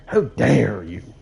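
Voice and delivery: mock indignation. Repeats the indignant outburst.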